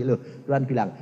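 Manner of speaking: ordinary delivery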